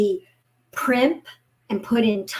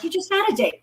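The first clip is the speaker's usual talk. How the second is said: put-on exasperated kids; 8 semitones higher, faster than usual. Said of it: "They're like".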